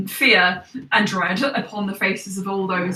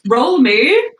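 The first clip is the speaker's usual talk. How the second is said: loud call; 4 semitones higher, 7 dB louder than usual. Acted. GM calls for a roll.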